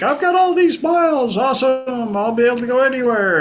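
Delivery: very high, gleeful gushing; exaggerated giddy excitement; mock elation. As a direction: crow over a windfall in a hammed-up high voice. Acted delight at collected air miles.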